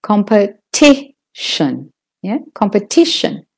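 "competition" with lighter COM, stronger TI; stress on TI. Shown as a demonstration. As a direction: make TI the peak of "competition". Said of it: "isn't as loud or long as the T".

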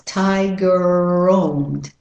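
very slow "Tiger roamed" on one stretched R; no gap between "Tiger" and "roamed"; American "Tiger roamed" melted into one word; elided R. Shown as a demonstration. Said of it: "drop one of the R's".

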